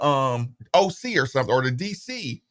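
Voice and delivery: usual speaking voice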